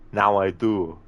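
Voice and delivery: action-hero movie impression, forceful; Arnie. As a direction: play the menacing action hero interrogating his captive. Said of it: "an Arnie movie scene impression".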